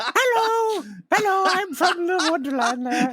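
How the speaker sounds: very high sing-song greeting; new neighbor character. Retold or imitated. Cohost joining the bit as a high sing-song neighbor. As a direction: greet like a kids' show newcomer. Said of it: "That's a great character".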